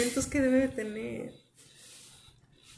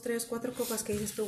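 ordinary delivery

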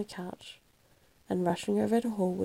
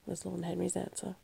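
ordinary delivery, nothing marked

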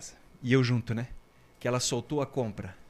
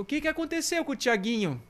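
high, questioning voice; a concerned old man asking; high-voiced pharmacist calling out; curious neighbor; 11 semitones higher than usual. Pharmacist asks in retold story.

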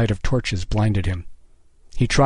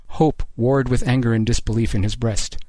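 plain reading voice

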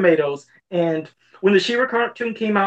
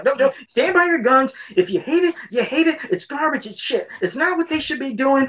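higher-pitched impassioned urging, imitated; mock indignant friend laying down the verdict; Tyler; 6 semitones higher than usual. Friend urging Joe to stand by his opinion, mock indignant. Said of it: "That's what Tyler would do".

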